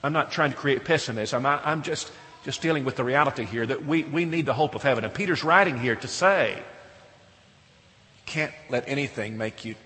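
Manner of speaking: normal talking voice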